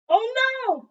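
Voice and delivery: high, loud "Oh no!", then slow; sudden alarm. Acted. Child suddenly remembering grandfather's cows, high loud alarm. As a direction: gasp out the realization.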